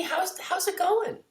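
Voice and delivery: high, drawn-out neighbourly hello; lilting, high-voiced neighbour asking; acted friendly greeting. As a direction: pitch it way up and stretch the greeting.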